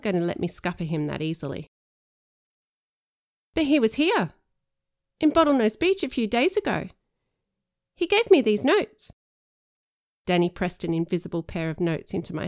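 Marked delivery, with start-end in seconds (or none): 3.5-9.1 higher, louder, pressing his case; insistent boy. Pretend. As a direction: push the point hard. Danny insists in role.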